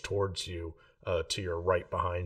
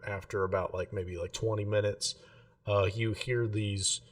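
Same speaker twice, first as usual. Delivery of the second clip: normal talking voice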